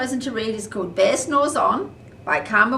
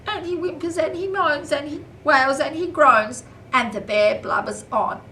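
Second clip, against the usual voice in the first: whimpering; 7 semitones higher, 5 dB louder than usual. A narrator's reading. Bear whimpering, blubbering.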